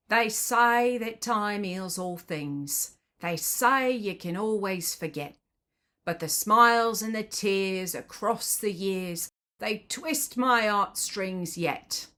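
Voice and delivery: slow Cockney lyric, h's dropped; broad Cockney vowels, drawn out; Cockney accent. Shown as a demonstration. Reading the second verse to viewers in slow Cockney. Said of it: "if you're not familiar with Cockney".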